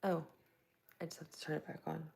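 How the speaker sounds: ordinary talk, nothing marked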